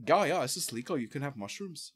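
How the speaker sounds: put-on soft, offhand Dutch-accented seller. Amsterdam seller reassuring an American customer, put-on Dutch accent. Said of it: "I'll dig the accent".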